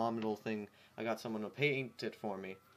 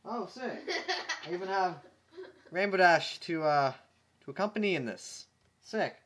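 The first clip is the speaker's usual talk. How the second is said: excited; 5 semitones higher than usual. Real, not acted. Excited about matching costume.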